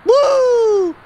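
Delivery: very high, loud whoop; whooping cheer; excited whoop. Real, not acted. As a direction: let out a thrilled whoop. Cheering while jumping in.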